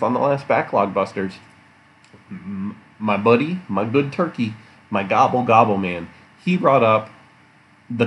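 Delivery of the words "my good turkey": usual speaking voice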